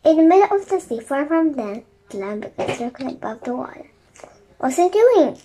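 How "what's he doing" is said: higher, louder puzzled cry; raised storybook question; cried question. A narrator's reading. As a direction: call out in alarmed confusion. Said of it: "cried Jack".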